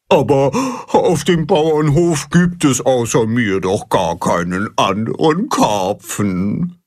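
whining carp. Acted. Carp Baldrian complains to Timme, whining. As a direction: whine it like a sulking fish. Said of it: "jammert Baldrian".

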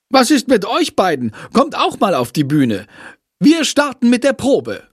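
high-pitched, mildly cross teacher; scolding. A narrator's reading. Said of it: "schimpft Frau Schmidt". (leicht schimpfend, mit erhöhter Stimme)

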